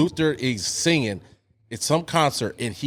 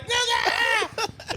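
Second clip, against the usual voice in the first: high, crying fan wail of the name; crowd screaming. Retold or imitated. Imitating fans wailing for [name], high and crying. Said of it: "And everybody's like".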